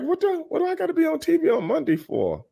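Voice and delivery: disbelieving what did I just read; baffled. Retold or imitated. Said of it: "And I'm like".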